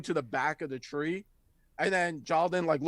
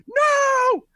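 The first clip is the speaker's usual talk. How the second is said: very high, loud drawn-out wail; kids protesting; 9 dB louder than usual. Acted. Kids yell at the dwarf leaping up the tree, high squeal. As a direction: squeal it like a small child. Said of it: "they're like".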